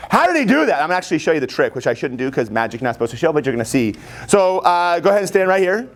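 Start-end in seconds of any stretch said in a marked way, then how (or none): 0.1-0.7 high-pitched mock wonder; retold amazement. Retelling first seeing a magic trick at a party, amazed. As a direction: exclaim it like a baffled party guest, high. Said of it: "I was like".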